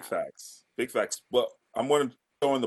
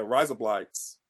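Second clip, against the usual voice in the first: quick, lower RISE UP LIGHTS; said fast; 4 semitones lower, slower than usual. Shown as a demonstration. Retrying faster for the co-host, quick and lower.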